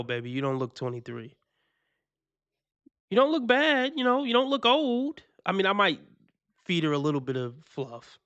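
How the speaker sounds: ordinary delivery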